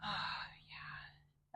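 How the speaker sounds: breathy whispered oh yeah; whispered relief. Real, not acted. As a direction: whisper it as the stretch lands. Sighs while deep in twist.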